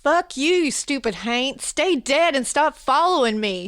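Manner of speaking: put-on loud, high Southern-accented yell; angry Southern holler; southern shout. A narrator's reading. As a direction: shout it at a crowd in a southern twang. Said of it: "he shouted to the crowd".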